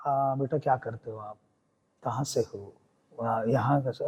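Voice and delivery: a hint of a kind elderly actor; kindly elder. Celebrity questions nervous newcomer.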